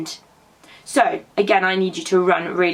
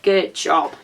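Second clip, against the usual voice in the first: usual speaking voice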